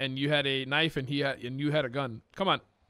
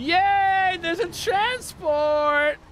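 high, slow excited cry; 13 semitones higher, 5 dB louder, much slower than usual. Real, not acted. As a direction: cheer it out. Excited at transport arriving.

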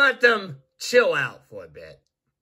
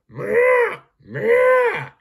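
much higher, louder playful kitten meows; cat meowing; 6 dB louder than usual. Acted. Fluffy the cat answering the builder with high meows. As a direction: meow like a small cat answering its owner. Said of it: "Right, Fluffy?".

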